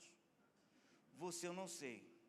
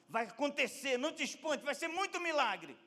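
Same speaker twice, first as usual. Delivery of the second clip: high, loud preacher's promise; impassioned preaching; 9 semitones higher, 8 dB louder than usual. Acted. Preacher promising the congregation a miracle, impassioned. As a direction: raise the pitch and proclaim it with force.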